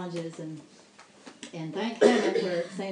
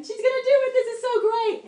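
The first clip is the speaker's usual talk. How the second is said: much higher and louder, bursting with joy; thrilled; 9 dB louder, faster than usual. Retold or imitated. Telling the beauty parlor staff her mom will come, overjoyed. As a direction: squeal the good news.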